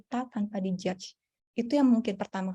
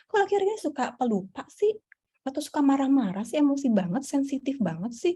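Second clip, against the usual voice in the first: higher-pitched, questioning complaint; imitated puzzled friend; 5 semitones higher than usual. Psychologist voicing people around who notice mood changes, puzzled nagging.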